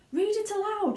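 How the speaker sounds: high, eager command; an excited grandpa urging the father; shouted. Acted. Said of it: "shouted Grandpa Joe".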